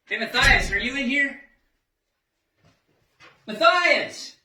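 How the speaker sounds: calling a name into another room. Acted. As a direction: call out for someone who may be nearby. Searching for Matthias, calling out.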